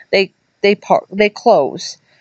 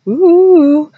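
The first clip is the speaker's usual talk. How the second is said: spooky ooh; 11 semitones higher, 6 dB louder than usual. Acted. Spooky moment in ghost story.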